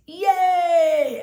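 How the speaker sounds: high, loud yay; shrill happy yay; cheer. Real, not acted. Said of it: "why I say yay".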